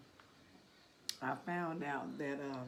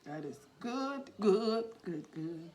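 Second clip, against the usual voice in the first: high, loud burst of delight; 4 semitones higher, 7 dB louder than usual. Real, not acted. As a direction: rave about the taste. Tasting sweet corn.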